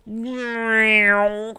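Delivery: long high-pitched cartoon whoosh; sustained high zooming noise. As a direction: imitate a cartoon streak shooting through the sky. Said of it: "like the cartoon sound effect attached to it".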